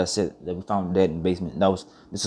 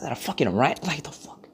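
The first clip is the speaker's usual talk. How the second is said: startled whisper; 7 semitones higher than usual. Retold or imitated. Startled seeing rat run.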